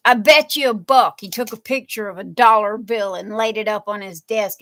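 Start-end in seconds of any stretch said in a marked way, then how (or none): 0.1-1.2 loud mock dare, punch "bet"; husband's challenge. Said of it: "And he goes".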